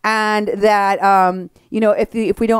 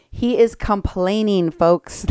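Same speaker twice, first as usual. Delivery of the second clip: ordinary talk, nothing marked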